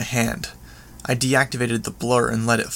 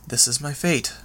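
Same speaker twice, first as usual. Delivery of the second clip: ordinary delivery, nothing marked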